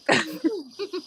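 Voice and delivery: high, breathless giggle; can't stop giggling. Real, not acted. Host laughing with friend on stream, high giggle.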